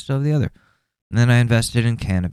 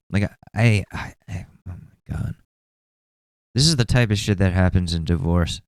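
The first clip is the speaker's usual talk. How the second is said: usual speaking voice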